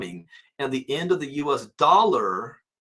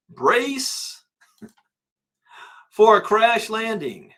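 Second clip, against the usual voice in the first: high, loud, slow doom-laden voice; ominous announcement; 8 semitones higher, 5 dB louder, much slower than usual. Pretend. Host voicing Kiyosaki's warning to viewers, mock-dramatic doom. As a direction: boom it out like a disaster trailer.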